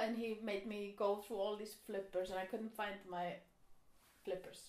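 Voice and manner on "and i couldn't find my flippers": ordinary talk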